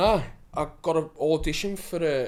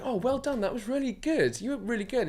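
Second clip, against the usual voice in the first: higher, mimicked female praise; mock-sweet high voice of the casting woman; gushing casting woman; 8 semitones higher than usual. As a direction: imitate her gushing encouragement, pitched up. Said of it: "she was like".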